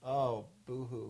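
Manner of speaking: slow mock sympathy; drawn-out fake pity; sarcastic boo-hoo.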